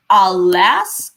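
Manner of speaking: light "A", long lifted "las" in "Alas"; stress on second syllable. Shown as a demonstration. Teacher splitting up the verse, stress on the "las" of "Alas". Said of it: "Last is stressed".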